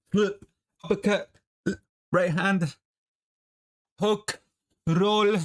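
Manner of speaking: calling combos. Shown as a demonstration. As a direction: bark the combo like a trainer. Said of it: "Or you can go like this".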